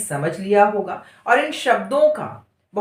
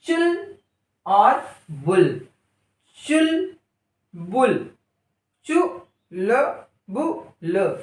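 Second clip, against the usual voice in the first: Chulbul drawn out very slowly; slow stretched reading; much slower than usual. Shown as a demonstration. Teacher blending the word for pupils, slowly. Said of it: "अगला शब्द चुल".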